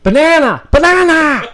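loud, high yelled word; shouting. Acted. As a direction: yell the safe word out.

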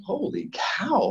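a slow, awed, tearful "holy cow"; stunned exclamation. Retold or imitated. Dad stunned by daughter's words.

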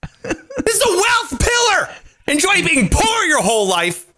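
high, shouted hammed-up outburst, cracking into a laugh; fake-yell at the commenter for his sarcasm; mock angry rant. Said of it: "I'm sorry I got angry".

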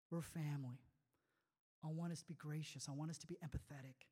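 usual speaking voice

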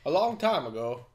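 amateur Cockney storyteller impression; Michael Caine accent. Film fan re-enacting a favorite monologue, imitated Michael Caine accent. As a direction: tell it gravely in an imitated British accent. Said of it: "Wish I could do a better".

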